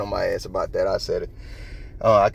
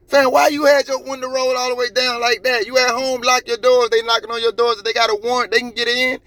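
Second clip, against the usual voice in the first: high-pitched, incredulous rant; exasperated scolding; 13 semitones higher than usual. Real, not acted. Vlogger warning a driver not to invite police in, exasperated rant. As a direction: hammer the warning home, voice pitched up.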